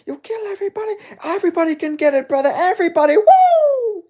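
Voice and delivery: very high mimicked scream, loud woo at the end; Hamza. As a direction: scream it in a wild falsetto. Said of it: "When Hamzat scream, he's like".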